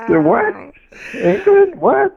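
high incredulous the what, England, what; mock disbelief. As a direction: act floored by the idea. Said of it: "mock disbelief at England".

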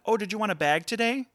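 bright high cashier voice; chirpy cashier asking a customer; customer service voice. Retold or imitated. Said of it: "my customer service voice".

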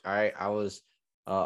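normal talking voice

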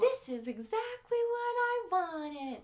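very high, loud doll squeal, stretched out; pull-string doll crowing slowly; gasp, then shrill slow toy voice; talking doll. Acted. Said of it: "That's exactly how she talks".

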